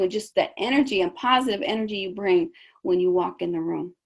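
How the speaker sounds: usual speaking voice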